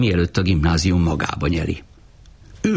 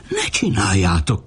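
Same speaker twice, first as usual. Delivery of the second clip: ordinary delivery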